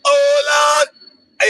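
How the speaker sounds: ordinary delivery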